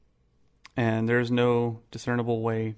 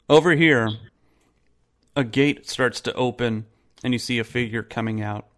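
normal talking voice

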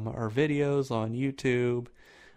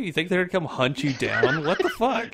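high, incredulous comic rant; mock exasperation; 6 semitones higher, faster than usual. Man scoffs at girls freaking out over family, mock exasperation. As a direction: rant in disbelief, close to laughing.

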